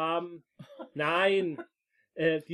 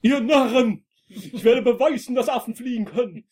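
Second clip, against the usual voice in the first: mad scientist ranting loudly; mustache twirling villain; 6 semitones higher, 10 dB louder than usual. Acted. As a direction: shout it like a crazed genius. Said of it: "einen verrückten Wissenschaftler".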